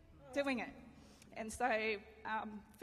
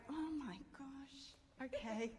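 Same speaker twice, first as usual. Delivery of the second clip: humbled giving in; 5 semitones higher, much slower than usual. Retold or imitated. Wife accepting her husband's help, humbled. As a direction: let your pride melt and give in.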